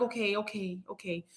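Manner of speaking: soft, trailing-off okays; retold meek okay. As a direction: give in quietly, voice dropping away. Giving in to friend's urging.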